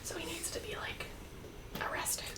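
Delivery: breathy whisper. Acted. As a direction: whisper so no one hears. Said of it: "What are you guys whispering about?".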